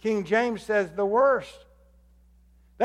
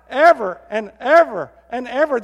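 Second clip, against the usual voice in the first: each "ever" hit louder and higher; emphatic loud repetition; 5 semitones higher, 9 dB louder than usual. Shown as a demonstration. Pastor proclaiming the verse's ending, loud and emphatic. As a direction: build the word "ever" into a proclamation. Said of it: "That's an emphasis".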